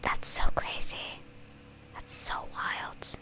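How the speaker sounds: hushed amazed whisper. Real, not acted. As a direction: breathe it out amazed. Amazed reaction.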